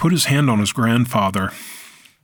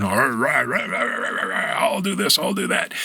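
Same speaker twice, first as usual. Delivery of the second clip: mocking a braggart; 2 semitones higher than usual. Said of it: "a braggart".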